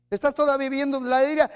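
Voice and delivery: ordinary talk, nothing marked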